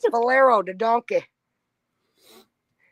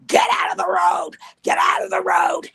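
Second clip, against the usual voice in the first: strained mock shout, rising in pitch; imitated screaming. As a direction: imitate a park worker screaming people off the road. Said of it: "screaming at the top of her lungs".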